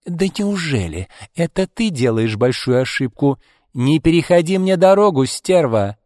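acted bitchy fury; angry threat. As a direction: warn your rival off. Rival threatens her in quarrel.

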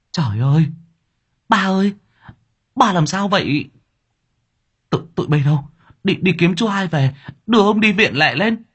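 high, loud distraught cries; daughter-in-law crying over her dying father-in-law, frantic; panicked woman. Acted. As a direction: cry out for father then order help.

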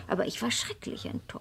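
whispered in mock dismay; mock disappointed whisper. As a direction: hostess confiding her disappointment to a guest, whispered. Mock disappointment about the conspirator.

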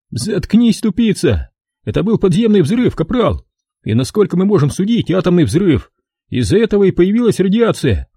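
a wiseacre spinning his theory; cocky know-it-all soldier. A narrator's reading. Evans plays professor.

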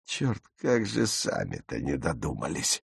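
low, soft voice to oneself; lightly voiced grudging admiration, low and soft; mock regretful musing. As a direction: think it aloud, dropped well down. Nazis' admiring thought voiced by narrator.

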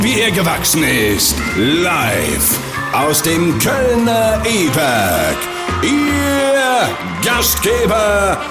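usual speaking voice

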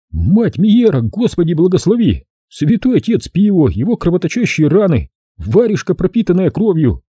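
high, lisping whistle of a voice; whistling lisp voice. Acted. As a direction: lisp it high like a ghostly child. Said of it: "почти свистящим голосом".

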